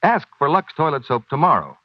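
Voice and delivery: normal talking voice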